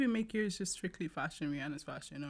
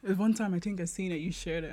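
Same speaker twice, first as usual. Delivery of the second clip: ordinary talk, nothing marked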